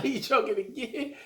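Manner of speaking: laughing. Retold or imitated. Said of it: "I'm like".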